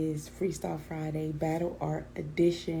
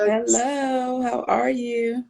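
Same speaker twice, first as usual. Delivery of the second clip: loud, high cheerful hello; loud greeting; 5 semitones higher, 7 dB louder than usual. Real, not acted. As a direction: call out a big hello. Greeting the guest loudly.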